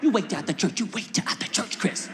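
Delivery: threatening mother; mama's threat. Retold or imitated. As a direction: hold it over him till church ends. Mama threatening.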